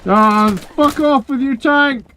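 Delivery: high, slow furious yell; angry shout. Real, not acted. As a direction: drag out the curse in rage. Pinned down by a tank.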